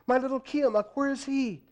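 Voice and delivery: high pleading worried call; anxious cry. A narrator's reading. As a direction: fret aloud for the grandson. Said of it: "the old woman grandmother cried".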